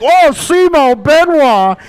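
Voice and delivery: drawn-out, high and loud; excited. Retold or imitated. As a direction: savor the name slowly, thrilled. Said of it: "It's like".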